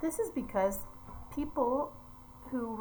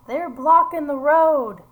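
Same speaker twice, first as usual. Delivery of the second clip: much louder, higher, slow irritated line; annoyed complaint; 6 semitones higher, 13 dB louder than usual. Acted. Teacher voicing an irritated bystander complaining about marchers, loud and slow. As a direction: grumble it like a put-out driver. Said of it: "this person with lighter skin says".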